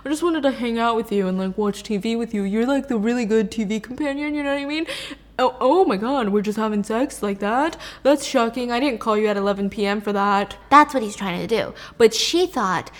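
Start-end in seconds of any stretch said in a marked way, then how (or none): 0.0-10.6 1 mock innocent Floyd. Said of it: "He's like".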